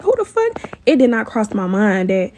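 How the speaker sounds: ordinary talk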